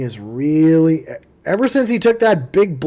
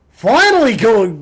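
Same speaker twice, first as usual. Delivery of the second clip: higher, louder relieved cheer; real fan relief; excited outburst; 6 semitones higher, 8 dB louder than usual. Real, not acted. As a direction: let the finally burst out.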